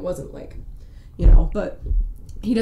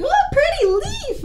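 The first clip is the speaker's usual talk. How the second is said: high, delighted gasp at a leaf; childlike wonder. Retold or imitated. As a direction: squeal it like a dazzled kid. Kid distracted by a leaf.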